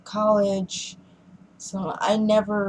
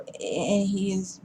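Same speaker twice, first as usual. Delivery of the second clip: ordinary talk, nothing marked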